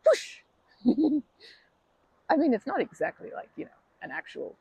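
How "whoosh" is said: loud imitated whoosh; whoosh sound. Miming the rock being thrown away, loud whoosh. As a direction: voice the rock flying off.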